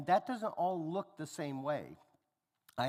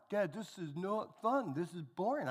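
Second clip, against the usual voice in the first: put-on bored kid complaint; 5 semitones higher than usual. Son complains to dad.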